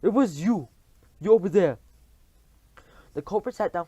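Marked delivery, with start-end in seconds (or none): 0.0-1.8 high, loud raging accusation; furious accusation. Acted. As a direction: point out the culprit furiously. Witch queen accusing in rage.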